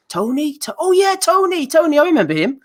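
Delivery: high, vague voice, pretending to barely remember the name; a high-pitched Joshua who vaguely recalls someone; high mock Joshua. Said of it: "Joshua's a bit like".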